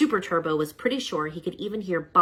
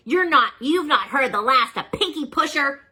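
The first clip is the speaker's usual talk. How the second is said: louder, higher retreating threat; villain's parting cry; 8 semitones higher, 6 dB louder than usual. Acted. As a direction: yell it while fleeing. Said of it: "he cried".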